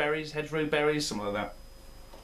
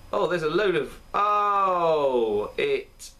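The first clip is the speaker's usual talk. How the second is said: startled rising "oh", louder and higher; real surprise at the sediment, the voice jumping up; sudden exclamation at the bits in his beer; surprised oh; 4 semitones higher, 4 dB louder, much slower than usual. Real, not acted. Spotting sediment in the glass.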